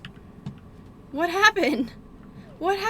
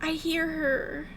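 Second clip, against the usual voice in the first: hushed; 6 dB quieter, slower than usual. Real, not acted. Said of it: "I can't move".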